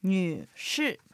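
女士 in Mandarin, nǚshì with 女 dipping and rising in the third tone and 士 falling in the fourth; teacher models 女士 slowly and clearly; Chinese drill word. Shown as a demonstration. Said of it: "では、練習しましょう". (女士を中国語で、女は三声で下がって上がり、士は四声で下げる)

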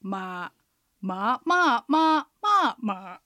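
まあ sung through third, second, fourth, first, fourth, third tones; tone demo on ma. Shown as a demonstration. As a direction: trace the tone contour of each まあ. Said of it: "まの発音を使ってアクセントの流れ確認".

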